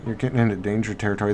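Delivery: ordinary talk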